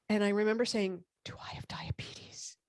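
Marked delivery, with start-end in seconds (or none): 1.3-2.6 hushed, frightened whisper; whispered fear. Retold or imitated. Whispering to the doctor, scared of diabetes. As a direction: breathe the worried question almost silently. Retold fearful question to doctor.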